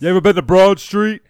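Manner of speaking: loud, high, put-on Philly heckler; Philly fan accent. Phillies fan taunting the home crowd, loud Philly accent. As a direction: shout it in a hammed-up Philadelphia accent. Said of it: "did you like my accent".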